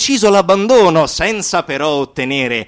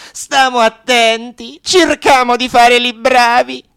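avvertimento alto e beffardo; 9 semitones higher, 5 dB louder than usual. Acted. Voce minacciosa in dialetto.

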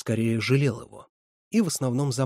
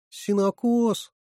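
much higher, slow, moaning "Сенокос?"; groaning question; 10 semitones higher, 4 dB louder, much slower than usual. A narrator's reading. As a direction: stretch it out in dismay. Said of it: "простонал Лёня".

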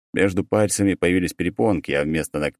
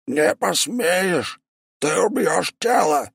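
demon voice; 13 semitones higher, much slower than usual. Acted. Possessed demon answers back.

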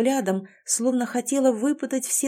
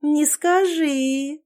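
slow, higher, stretched vowels; drawn out; 6 semitones higher, 3 dB louder, much slower than usual. Acted. Лана studies the heroine with curiosity, drawling doubtfully. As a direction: draw it out doubtfully. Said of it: "протянула Лана".

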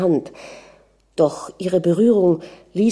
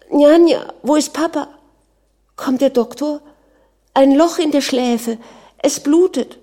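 put-on high-pitched, panicky little girl; frightened child crying out; 8 semitones higher, 5 dB louder than usual. A narrator's reading. Scared Manja asks nanny about bleeding mother, crying out. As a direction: call for nanny in a child's alarm. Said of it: "blieb in einiger Entfernung stehen und schrie".

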